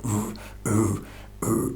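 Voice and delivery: soft, deep grunting hoots, like a beast in the dark; imitating deep grunting. Retelling the creature's noise to listeners, soft deep grunts. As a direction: mimic the creature's low grunt outside the trailer. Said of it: "this deep kind of grunting".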